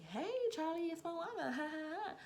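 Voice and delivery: high mocking greeting with fake laugh; sarcastic high-pitched phone hello; Moana, sarcastic. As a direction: mimic the boss in an exaggerated high singsong. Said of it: "Like, being sarcastic".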